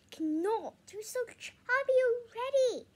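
high, loud teasing sister. A narrator's reading. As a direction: tease him bluntly.

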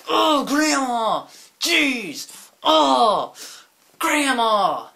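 high, drawn-out whining outburst; mock tantrum. Grandchild complaining about Grandma's awful cookies, whiny mock tantrum. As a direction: wail in exasperation, each phrase falling from high.

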